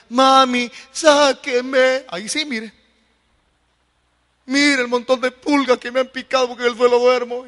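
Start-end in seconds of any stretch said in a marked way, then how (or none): 0.1-2.1 high, drawn-out mock wail; pleading jailed youngster. Jailed grandson begs his mother to get him out, wailing. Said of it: "el hipote ahí muriendo en la cárcel". 4.5-7.5 high-pitched mock whine; complaining jailed youngster. Jailed grandson complains to his mother, whining. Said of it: "el hipote ahí muriendo en la cárcel".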